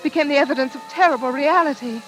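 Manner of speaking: measured, reflective retelling; story narration. A narrator's reading. April narrating her ordeal to the listeners. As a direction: recall the horror calmly, looking back.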